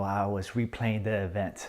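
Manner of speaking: normal talking voice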